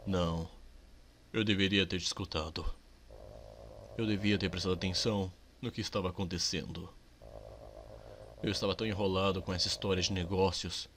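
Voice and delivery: low, soft, remorseful father; lightly voiced father. Father apologizing to his son, low and soft. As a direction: apologize gently as a regretful dad.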